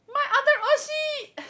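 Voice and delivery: high, loud, laughing cry; laughing gush. Real, not acted. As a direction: burst out laughing in fan delight. Fan gushes while laughing.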